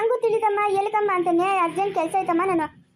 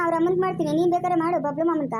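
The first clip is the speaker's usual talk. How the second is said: ordinary talk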